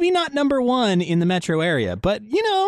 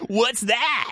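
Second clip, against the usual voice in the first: higher put-on DJ voice; radio voice; 8 semitones higher than usual. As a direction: keep the radio voice going. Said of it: "I don't like this voice".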